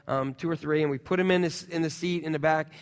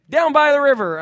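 comic quote; 9 semitones higher, 9 dB louder than usual. Retold or imitated. Quoting a comic line.

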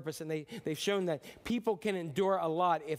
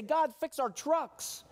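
high, slow whining plea; childish plea; 10 semitones higher, 3 dB louder, much slower than usual. Retold or imitated. Believers begging God like children, high slow whine. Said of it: "and we say".

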